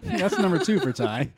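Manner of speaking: laughing. Real, not acted. Friend eating second donut.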